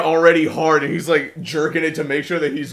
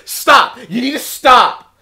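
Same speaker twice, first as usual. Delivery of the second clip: loud, higher, slow mock telling-off; retold scolding; 6 semitones higher, 8 dB louder, slower than usual. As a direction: imitate a mother shouting an order. Retold mother scolding son.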